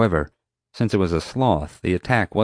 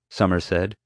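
ordinary delivery, nothing marked

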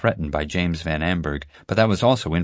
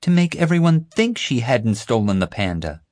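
sharp; 6 semitones higher, 4 dB louder than usual. A narrator's reading. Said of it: "Violet replied sharply".

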